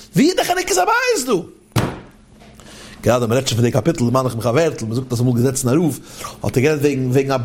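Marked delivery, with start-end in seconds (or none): 0.1-1.5 high-pitched, incredulous question; baffled outcry. Acted. Teacher challenging the psalm's title after listing troubles, baffled. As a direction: throw the question up high, demanding an answer.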